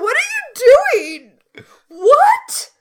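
loud, high yell of disbelief; high, drawn-out appalled shouting; appalled outburst. Acted. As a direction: shout it down in horror. Appalled reaction to the acted seduction.

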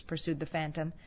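usual speaking voice

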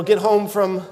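ordinary talk

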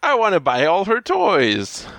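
mock eager kid. Parodying kids in an advert.

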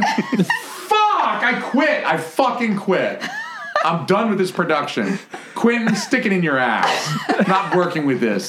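high, hammed-up outrage; mock diva tantrum. Actor quits the production after flubbing lines, mock angry. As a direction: storm off in pretend fury, half laughing.

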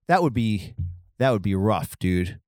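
ordinary talk

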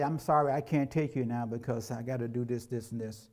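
a hint of a guilty dad backing out; mild quiet excuse. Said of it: "you go to him and say".

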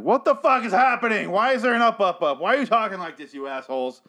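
high-pitched ranting disbelief; indignant yelp, way up in pitch; exasperated outburst. Retold or imitated. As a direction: replay a furious rant at the screen. Retold exasperated outburst at the show.

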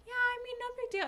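very high, unconvincing casual voice; high-pitched mock-innocent brush-off; feigned nonchalance. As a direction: squeak it out as if it's nothing. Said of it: "trying to be nonchalant about it".

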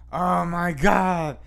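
drawn-out mock swoon of a teacher; teacher going nuts. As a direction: imitate a teacher gushing, very slowly. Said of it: "the teacher would go nuts".

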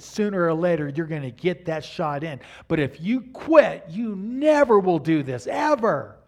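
stern pep talk from dad; firm fatherly urging. Retold or imitated. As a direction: push the kid back on his feet. Father scolding son on floor.

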